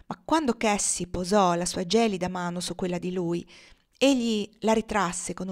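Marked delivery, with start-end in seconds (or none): none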